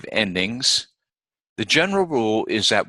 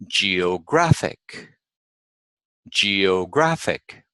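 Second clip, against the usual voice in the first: GEOGRAPHIC slowly as geo-GRAPH-ic; slow teacher's GEOGRAPHIC, stressed on GRAPH; penultimate stress; much slower than usual. Shown as a demonstration. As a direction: lift the pitch on GRAPH in GEOGRAPHIC. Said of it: "the second syllable from the end".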